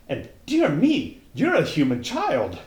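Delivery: put-on a young fawn crying out in delight. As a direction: burst out with glee at being free. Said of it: "it cried out in a voice of delight".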